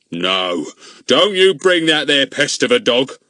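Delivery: crying out. A narrator's reading. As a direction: cry out in protest. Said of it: "cried Mister Goon".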